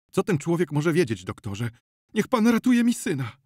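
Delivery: high, loud desperate appeal; distraught mother begging for help; frantic pleading. Acted. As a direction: plead urgently, pitch climbing high. Frantic mother begs the doctor.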